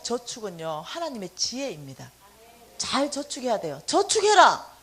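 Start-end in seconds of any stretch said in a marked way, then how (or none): 3.9-4.8 louder, higher stern order; God's sharp firm answer; stern command. Retold or imitated. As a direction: bark it like a strict father. God's reply, mimicked sharply.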